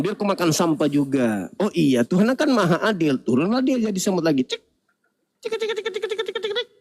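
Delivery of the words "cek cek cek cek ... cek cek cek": high, soft rapid tick-tick-tick; cartoon scurrying noise; tiny creature scuttling. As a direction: imitate tiny ant feet in a small squeaky patter. Imitated patter as God becomes ant.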